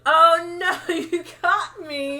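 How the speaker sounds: high, loud hammed-up gasp; laughing mock confession; mock caught vampire. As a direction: ham up being caught red-handed. Retelling vampire caught, mock dramatic.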